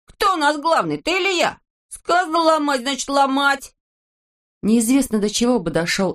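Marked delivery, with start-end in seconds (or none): 0.1-3.7 high-pitched bossy character voice; squabbling, indignant high voice; bossy quarrelling. Acted. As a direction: insist on being in charge, voice high. Acted quarrel over who is boss.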